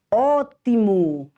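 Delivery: Ótimo said slow and loud, open Ó; slow model word. Shown as a demonstration. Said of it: "Acento agudo no O".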